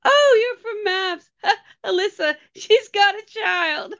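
high, slow, breaking into laughter; a high squealing fan voice, laughing through it; mock excited fan. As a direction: imitate a gushing stranger while laughing. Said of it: "Some woman ran up to me".

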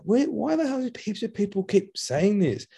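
high-pitched retold bewilderment; baffled disbelief. Retelling his own baffled reaction.

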